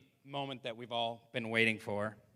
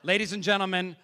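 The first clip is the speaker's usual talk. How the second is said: raised announcer voice building to a loud shout; announcer introduction; 4 semitones higher, 6 dB louder than usual. Real, not acted. Emcee introducing a great comic, big announcer build. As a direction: build up to a belted name.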